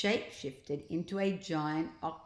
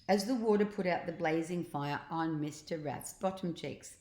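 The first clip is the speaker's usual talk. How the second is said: plain reading voice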